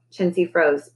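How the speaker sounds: plain reading voice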